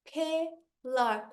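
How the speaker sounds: CATERPILLAR slowly split into four beats; "caterpillar" drawn out, one vowel at a time; syllable by syllable. Shown as a demonstration. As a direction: drop the jaw on each syllable of "caterpillar". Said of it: "quantas vezes o meu queixo cai".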